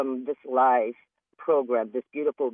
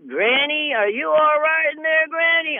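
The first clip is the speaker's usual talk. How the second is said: high, loud worried calling; mock-anxious call to Granny; 13 semitones higher, 4 dB louder than usual. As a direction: call through a closed door. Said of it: "they're like".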